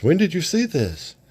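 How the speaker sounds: high, pressing question; sharp question. Retold or imitated. Sorceress questioning Sunman, high pressing tone. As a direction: demand the source. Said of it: "The sorcerer says".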